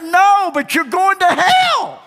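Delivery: high, loud blunt declaration; shouted hell warning. Retold or imitated. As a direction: blurt it out at the door. Repeating warning.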